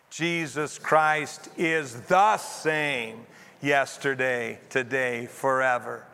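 slow recitation with "the" punched out; measured group reading, "the" fully stressed. Shown as a demonstration. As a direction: lean hard on the small word "the". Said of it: "put the emphasis on a different word".

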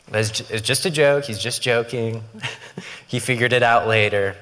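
usual speaking voice

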